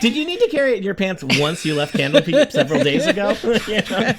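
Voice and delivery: laughing. Real, not acted. GM teases about the pants.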